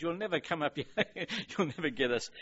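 put-on jeering in a hammy French accent. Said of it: "he's speaking in a French accent".

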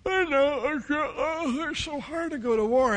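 high, loud whine of a reluctant king; whining. Acted. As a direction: moan like it's all too hard. King complains about war duty.

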